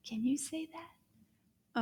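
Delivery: whispered word and prompt; slow voiceless coaxing. Shown as a demonstration. Teacher whispers to the children to repeat.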